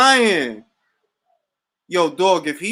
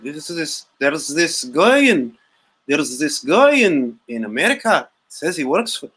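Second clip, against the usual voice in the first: put-on swooping, lilting Irish accent; mock Irish accent; 3 semitones lower than usual. Irish FBI agent reporting on a wiretapped live, mock Irish accent. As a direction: imitate an Irish agent's lilt. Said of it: "Irish F B I probably wiretap this".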